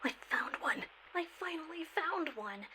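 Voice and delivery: hushed, breathless whisper. Acted. Ranger finally spotting the long-sought creature, whispering.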